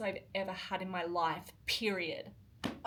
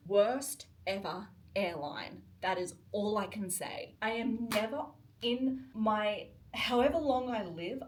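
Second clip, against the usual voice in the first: usual speaking voice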